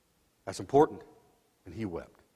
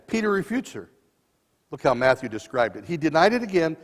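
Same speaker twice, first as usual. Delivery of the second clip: ordinary talk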